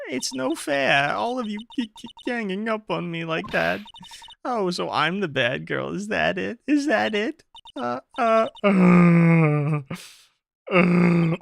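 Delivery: very high, put-on girlish whine building to loud wails; whining witness. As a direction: play the hard-done-by witness, squeaky and sulking. Voices witness April May.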